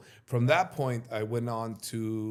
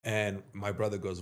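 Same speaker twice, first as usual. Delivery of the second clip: normal talking voice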